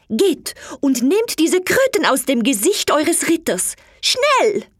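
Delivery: high, loud urgent command rising into a shriek on Schnell; calling out. Acted. Alina shouting at the servants to pull off the toads. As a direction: order the servants about in a panic. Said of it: "rief sie zu den Dienern".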